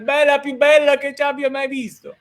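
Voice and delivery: high, exaggerated Italian compliment; over-the-top Italian accent, pitched high; hammed-up Italian. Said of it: "I love your Italian accent".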